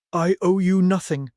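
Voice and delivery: sharp and loud, standing up to him; sharp defiance. Acted. Woman stands up to the man, sharp and defiant. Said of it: "her voice sharp".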